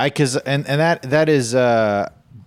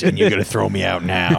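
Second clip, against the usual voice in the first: aggrieved New Yorker character; New Yorker guy voice. Acted. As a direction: drag it out as an indignant New York regular. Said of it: "The New Yorker".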